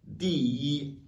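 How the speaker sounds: "di yi": falling "di", high level "yi"; first-tone yi. Shown as a demonstration. Teacher shows ordinal number, high level "yi" in "di yi". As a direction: drop "di", hold "yi" high and even. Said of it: "il se lit automatiquement yi".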